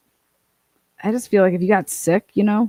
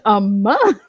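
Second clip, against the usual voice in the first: shocked disbelief; 2 semitones higher, 6 dB louder than usual. Retold or imitated. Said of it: "I said".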